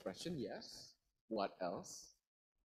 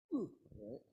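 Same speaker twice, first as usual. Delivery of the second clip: usual speaking voice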